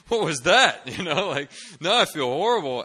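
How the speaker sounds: high, loud exasperated outburst; loud dismay. Retold or imitated. Student upset by friends' warnings, loud dismay. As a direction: burst out at useless comfort.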